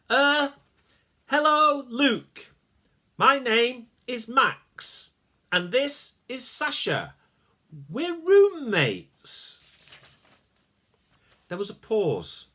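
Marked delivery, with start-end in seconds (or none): 0.1-9.6 very slow, loud, high careful speech. Acted. Max introducing himself to the tiny soldiers, very slow and loud. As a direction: stretch each word for tiny ears.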